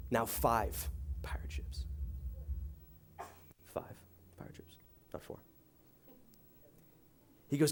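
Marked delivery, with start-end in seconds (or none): 0.1-5.4 dramatic whisper. A narrator's reading. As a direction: breathe it like a secret building dread. Dramatic reveal in pirate story.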